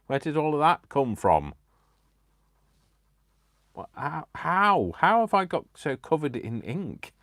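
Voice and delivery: high, loud startled exclamation; shocked to find ink everywhere; real surprise. Real, not acted. Crafter finding ink all over her hands, loud and startled.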